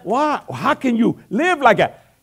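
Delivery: slow, loud, laughing glory to God; laughing exclamation. Real, not acted. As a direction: laugh it out to the crowd. Laughing exclamation mid-sermon.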